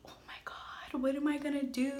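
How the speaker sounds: whispering in shock over the test; panicked whisper. Retold or imitated. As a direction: breathe the words out, scared and hushed. Alone after positive pregnancy test.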